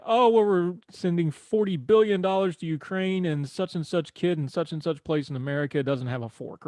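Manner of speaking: sarcastic imitation of an outraged critic; mocking critic. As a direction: parody a whiny critic of the aid. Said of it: "folks going".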